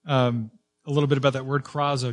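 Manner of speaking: ordinary talk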